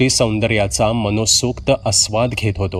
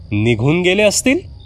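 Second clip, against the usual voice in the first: "निघून गेले असतील?" higher, rising in disbelief; incredulous echo; 7 semitones higher than usual. A narrator's reading. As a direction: repeat her words back, shocked. Narrator echoes her words in disbelief. (आश्चर्याने उंच सुरात प्रश्न)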